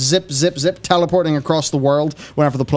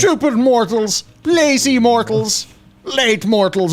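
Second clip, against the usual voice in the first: slow, high, booming villain; booming demon lord; 9 semitones higher, 3 dB louder, slower than usual. Pretend. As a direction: sneer each insult like a demon lord. Mocking villain voice of Blizzard lore.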